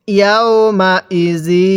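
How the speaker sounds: very slow, drawn-out syllables; model recitation. Shown as a demonstration. Teacher models Quran words for learners, slow measured recitation. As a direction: stretch each long vowel evenly. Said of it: "পড়ুন".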